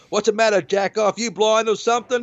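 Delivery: high-pitched mock heckle; irate old cowboy. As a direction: imitate an old guy calling out at a bad driver. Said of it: "an old guy with a bo-, cowboy hat".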